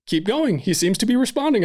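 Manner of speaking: attending doctor urging him on by text, excited; retold excited message. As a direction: read out the doctor's texts with rising excitement. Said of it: "She's like".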